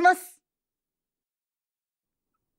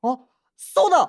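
louder, brighter exclamation; sudden realization; 5 semitones higher, 3 dB louder than usual. Acted. Boy suddenly remembers his plan, exclaiming. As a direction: blurt out as an idea hits. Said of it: "突然大声出して".

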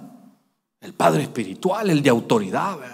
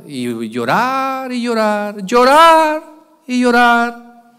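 mock the endless weeping, llorar drawn long; drawn-out crying words; 9 semitones higher, 7 dB louder, much slower than usual. Shown as a demonstration. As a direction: stretch each llorar slow, rising to a loud high peak. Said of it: "era de la llorada".

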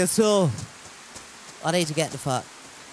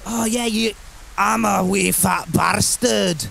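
slow, hammed-up Scottish accent; slower than usual. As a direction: play a broad comic Scot, drawn out. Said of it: "tap into my Scottish accent".

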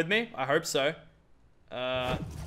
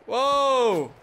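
high, loud surprised shout; startled whoa; 10 semitones higher, 7 dB louder than usual. Real, not acted. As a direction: caught off guard, cry out. Sudden sight while playing.

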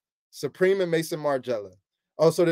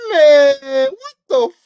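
shocked outburst; 9 semitones higher, 9 dB louder, slower than usual. Real, not acted. Shocked by connected shirts.

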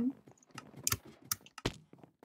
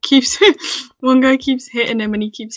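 laughing; 4 semitones higher, 9 dB louder than usual. Real, not acted. Laughing at the fight.

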